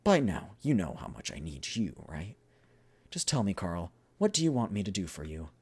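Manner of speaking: lightly acted, emphatic negotiation; earnest, lifted dialogue; deal-making game characters. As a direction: press the point in character. Voices two characters making a deal.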